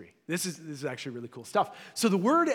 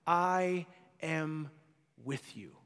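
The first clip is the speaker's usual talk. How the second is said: solemn, tender promise; 4 dB quieter, much slower than usual. Acted. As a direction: let each word land gently, then drop soft. Reading God's words to humankind.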